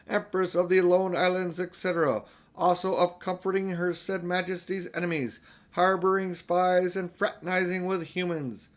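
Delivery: high, even official proclamation; reading out loud. A narrator's reading. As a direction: read it out like a formal decree. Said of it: "he read out loud the following words".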